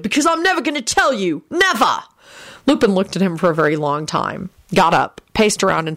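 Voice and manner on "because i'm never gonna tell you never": high, heated angry shouting; raised, angry character voice. A narrator's reading. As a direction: shout back furiously in a high-pitched voice. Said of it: "William shouted".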